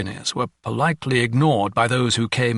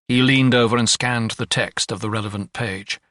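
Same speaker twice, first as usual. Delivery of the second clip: plain reading voice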